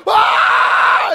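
high, loud breathy yelp of pain; pained scream. Retold or imitated. Husband whose hand is crushed, loud scream of pain. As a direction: cry out as a knuckle pops. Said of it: "And so I went".

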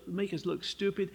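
ordinary talk

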